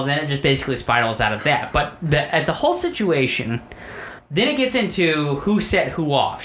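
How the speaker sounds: usual speaking voice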